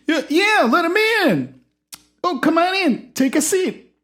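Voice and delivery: high eager host voice. Acted. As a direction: usher the guest in brightly.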